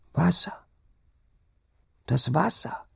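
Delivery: high-pitched feverish whimper. A narrator's reading. Sick wife crying out in delirium, whimpering. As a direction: whimper it high, in broken short cries. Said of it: "wimmerte die Kranke".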